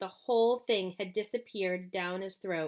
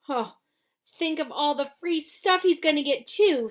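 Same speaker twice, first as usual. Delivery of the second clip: higher, louder envious whine; 6 semitones higher, 7 dB louder than usual. A narrator's reading. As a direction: sigh it out with envy. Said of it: "said a girl enviously".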